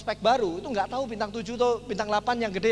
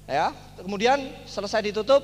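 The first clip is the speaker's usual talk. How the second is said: normal talking voice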